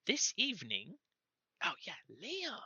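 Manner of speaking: high, fast gnome stammering; flustered gnome. Acted. Gnome messenger stammering a message, high and squeaky. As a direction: squeak and stumble like a nervous gnome. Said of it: "a high squeaky voice".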